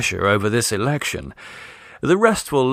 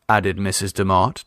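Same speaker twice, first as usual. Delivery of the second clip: ordinary delivery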